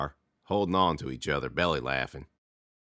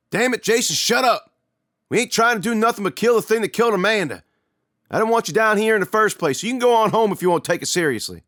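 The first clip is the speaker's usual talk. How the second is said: high, loud and stern; angry scolding; 13 semitones higher, 6 dB louder than usual. Acted. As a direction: tell the kid off hard. Lee scolds his brother.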